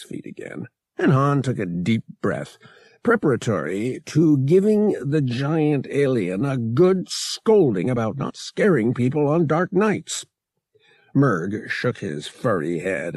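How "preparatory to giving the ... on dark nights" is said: usual speaking voice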